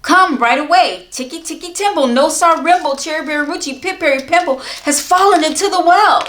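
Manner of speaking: high, loud urgent call. Acted. Boy calling the old man for help, urgent and loud. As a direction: shout for help, rushing the names.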